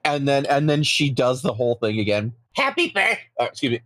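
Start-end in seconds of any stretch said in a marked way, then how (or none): 2.5-3.2 talking with food in mouth; words round a bite of food; mouth full. Real, not acted. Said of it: "I was eating anything".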